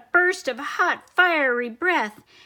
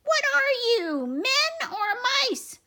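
much higher, scolding squeak; squeaky mouse voice; 12 semitones higher than usual. Acted. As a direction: taunt the troops in a tiny voice. Commander scolds troops.

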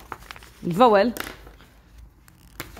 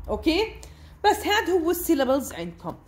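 ordinary talk

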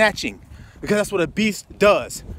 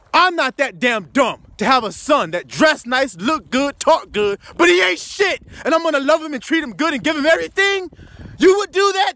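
angry shout; 6 semitones higher than usual. Real, not acted. Preacher shouting at the congregation about wicked sons, furious. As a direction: rail at them in disbelief. Preacher angrily rebuking the crowd.

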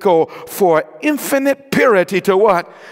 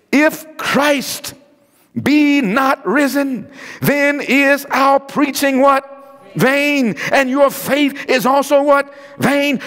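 slow, higher, drawn-out sermon cadence; emphatic preaching; 6 semitones higher, much slower than usual. Acted. Preacher driving home Paul's verse to the congregation, slow and higher. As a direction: stretch each word out from the pulpit.

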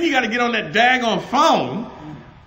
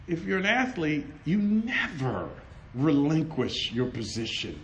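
ordinary talk

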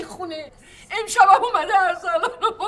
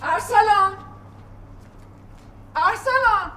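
calling out; 3 semitones higher, slower than usual. Acted. Mother calling through the house for her missing son, calling out. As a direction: call out for the missing boy, growing worried.

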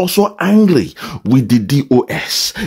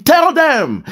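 sharp, much higher mock command; angry order; 13 semitones higher than usual. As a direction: bark it out like the angry man. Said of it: "he's angry".

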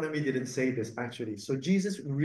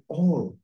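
all said alone, louder; stress on all; 4 dB louder than usual. Shown as a demonstration. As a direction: hit the single word all. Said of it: "and I emphasize this word".

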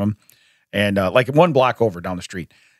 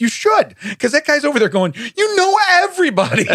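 teasing; 14 semitones higher than usual. Retold or imitated. Teasing friend, high voice.

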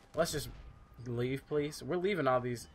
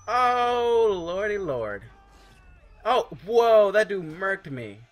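surprised yelp; 8 semitones higher, 11 dB louder, much slower than usual. Real, not acted. Killed suddenly in game.

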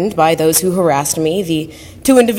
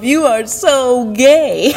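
slow, teasing self-jab, half laughing; joking tease; 5 semitones higher, much slower than usual. Retold or imitated. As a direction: tease yourself playfully, stretching it out. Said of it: "jokingly said to myself".

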